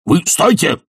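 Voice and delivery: high, abrupt angry shout. Acted. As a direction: cut in with a high cry. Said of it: "кричал Филипп Филиппович".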